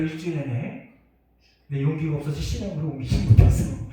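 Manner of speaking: normal talking voice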